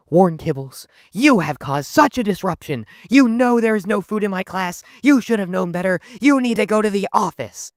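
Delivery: high-pitched mock teacher telling-off; scolding teacher. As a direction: imitate an indignant teacher sending a student out. Acted angry teacher scolding a student.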